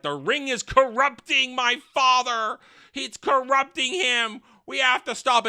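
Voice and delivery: high-pitched, alarmed mock Durin; agitated complaint. Durin complaining that the ring corrupts his father, high-pitched agitated mimicry.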